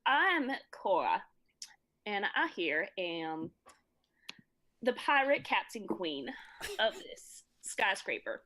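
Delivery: slow put-on Texas drawl; slow, grand Southern pirate queen; Southern accent. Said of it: "I will as well".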